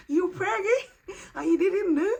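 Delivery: high, loud, laughing disbelief; amazed joy. Real, not acted. Mocking herself for not knowing, shrieking with laughter. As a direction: shriek it at yourself in delight.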